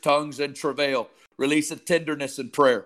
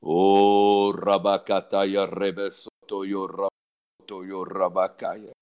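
a lower, slow, hushed murmur of tongues; 5 semitones lower, slower than usual. Real, not acted. Praying in tongues, low.

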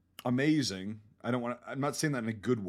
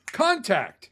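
higher, loud drill call; demo yell; 9 semitones higher, 7 dB louder than usual. Retold or imitated. Soldier who stepped on a mine calling out to his unit, loud drilled yell. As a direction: bark it out like trained reflex. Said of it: "you go".

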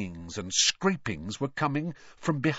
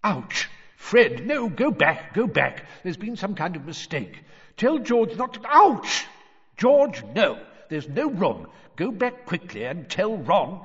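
higher, urgent warnings with yelps of pain; flustered alarm; 8 semitones higher, 4 dB louder than usual. A narrator's reading. Father stuck in the fireplace warning his sons, flustered. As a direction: send them back, urgently and higher. Squashed wizards shouting inside blocked fireplace.

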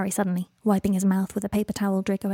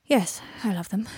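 resigned sigh. Acted. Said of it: "Draco sighed".

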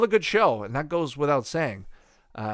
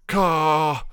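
caw squawk; 3 semitones higher, 5 dB louder than usual. Retold or imitated. Vlogger mimicking a cartoon kid's loud caw. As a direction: squawk it like a crow. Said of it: "guy with the red baseball hat going".

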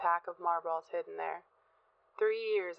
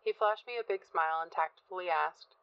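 ordinary delivery, nothing marked

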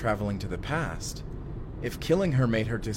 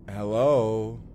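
louder call into an empty room; 6 dB louder than usual. Acted. Man calling into the empty building, louder. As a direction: call out to find anyone.

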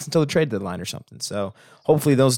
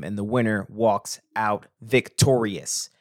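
normal talking voice

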